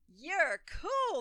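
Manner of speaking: loud, high declaration; declared. A narrator's reading. The gang declaring Ollie cool, loud. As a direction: proclaim it to him. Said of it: "they declared".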